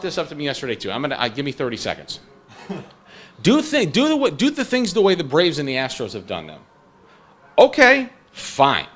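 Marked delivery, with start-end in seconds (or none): 3.4-8.9 raised, louder mock fan demand then retort; fired-up rant. Real, not acted. Podcast host firing back at impatient fans, louder and higher. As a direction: rise up and hammer the point.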